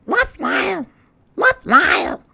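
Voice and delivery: high squawky parrot call; squawking bird. Acted. Bird squawking love at Lyle. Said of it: "squawked Bird".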